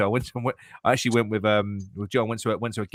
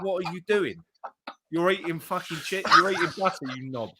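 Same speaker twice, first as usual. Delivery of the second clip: high, soft incredulous telling-off; mock scolding; 6 semitones higher, 6 dB quieter than usual. Woman scolding him for eating butter as cheese, mock scolding. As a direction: pitch it up, quietly exasperated at a clueless partner.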